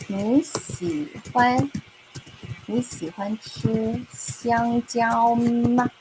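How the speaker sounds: very slow, syllable by syllable; slow model question. Shown as a demonstration. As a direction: spell out the question slowly for learners. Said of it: "I'm going to ask you".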